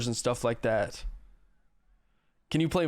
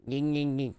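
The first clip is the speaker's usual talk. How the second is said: softer nasal-free yinking call; a quiet little deer call; 3 dB quieter than usual. Shown as a demonstration. Answering what deer do, soft deer call. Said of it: "what do deer do?".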